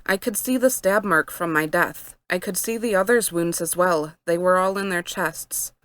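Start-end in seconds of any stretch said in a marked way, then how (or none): none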